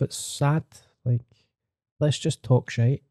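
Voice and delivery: ordinary talk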